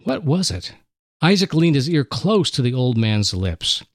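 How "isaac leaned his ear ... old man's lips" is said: plain reading voice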